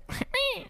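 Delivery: high squeaky mew; kitten mew. Pretend. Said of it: "Is that what a bat sounds like?".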